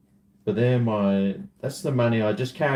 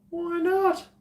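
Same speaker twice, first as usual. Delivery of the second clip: squeaky mock question. Mock reply, very high.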